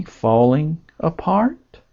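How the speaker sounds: "falling apart" slowly, lifting at the end like a question; slow "falling apart", tentative upturn on "apart"; questioning inflection. Shown as a demonstration. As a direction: echo "falling apart" back with a rising, questioning tune. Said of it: "with the inflection of it being a question".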